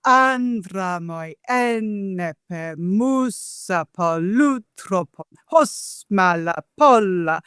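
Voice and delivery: slow, measured hexameter recitation of "andramoi, enepe, musa"; recited Homeric Greek. Shown as a demonstration. Scholar reciting Homer's Greek opening in meter. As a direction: chant the Greek slowly, marking each beat. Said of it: "meaning, as I said".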